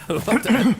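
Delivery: high, loud startled cry; shocked. Real, not acted. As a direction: blurt it out in alarm. Shocked at something on screen.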